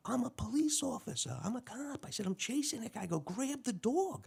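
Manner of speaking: high, strained mock-panic pleas; panicked cop. Cop retells begging the lady to grab her dog, high and panicked. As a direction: squeak out the orders like you're terrified.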